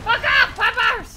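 high, loud yell; shouted rebuff. Real, not acted. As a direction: yell off attacking dogs. Fighting game dogs.

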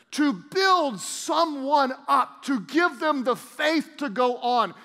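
slow, swelling pulpit preaching, last line shouted; preaching at full force. Acted. Pastor stirring the congregation, rising to a shout. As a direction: build to a shout on your words have power.

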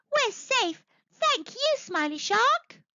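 very high, relieved little-fish voice; cheering. A narrator's reading. Freed fish thank Smiley Shark, high cheering voice. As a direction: give high-pitched, happy thanks. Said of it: "cheered the fish".

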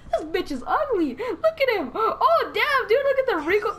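high-pitched, cracking up while talking; laughing mockery. Real, not acted. Player mocking a game character's face, laughing hard. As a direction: squeal the words out through laughter.

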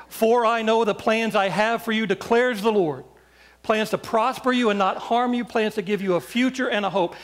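ringing, emphatic scripture recital; scripture proclamation. Acted. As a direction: proclaim the promise out to the whole room. Declares scripture over listeners.

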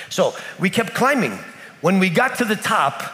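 usual speaking voice

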